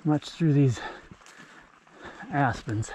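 ordinary talk

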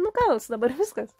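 usual speaking voice

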